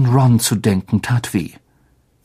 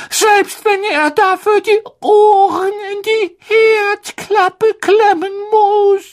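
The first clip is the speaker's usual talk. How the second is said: very high, slow, tearful elf plea; a desperate little house-elf near tears; 4 dB louder, slower than usual. Acted. Dobby the house-elf speaks, tearful.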